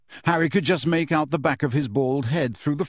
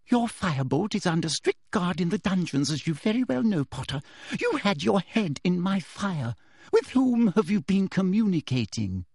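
Umbridge menace; 5 semitones higher than usual. Acted. Umbridge interrogating Harry, sugary menace. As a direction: press him sweetly.

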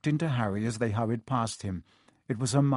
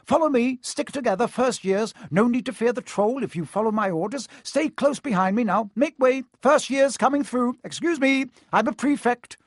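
high, loud pompous prefect; bossy Percy; 10 semitones higher, 4 dB louder than usual. Acted. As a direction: lead them importantly, puffed up. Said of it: "Percy was in his element".

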